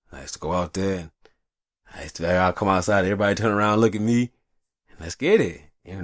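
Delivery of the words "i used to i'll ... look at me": ordinary talk, nothing marked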